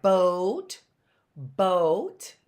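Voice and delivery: "boat" with a drawn-out long O, rising at the end. Shown as a demonstration. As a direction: stretch the oh in "boat". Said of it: "for the long O sound".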